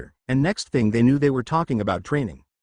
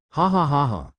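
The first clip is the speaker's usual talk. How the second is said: mocking demon laughter, lightly voiced; cruel laugh. A narrator's reading. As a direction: laugh at his punishment cruelly. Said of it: "The Kyuubi laughed cruelly".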